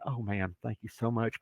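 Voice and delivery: soft, subdued mock gratitude; quietly feigned thankfulness; polite outward thanks. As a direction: keep it small and polite, hiding the protest. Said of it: "Outside, I'm going".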